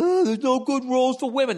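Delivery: high, whiny complaint; hammed-up grumble; mock whining woman. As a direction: whine it like a complainer. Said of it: "rather than sitting around".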